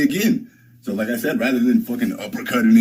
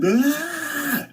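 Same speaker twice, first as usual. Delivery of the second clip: high mock scream of a panicking man; imitated scream; 3 dB quieter than usual. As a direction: squeal it out like him screaming. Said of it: "he's screaming the whole time".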